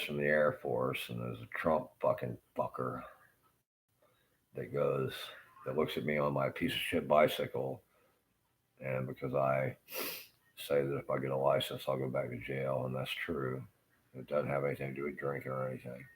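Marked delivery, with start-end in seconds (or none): none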